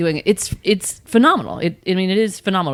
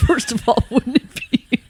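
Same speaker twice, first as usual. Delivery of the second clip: laughing; 7 semitones higher than usual. Real, not acted. Laughing at hard question.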